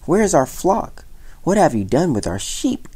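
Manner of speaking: high, indignant questioning; demanding. A narrator's reading. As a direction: demand an answer from the boy. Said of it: "demanded the villagers".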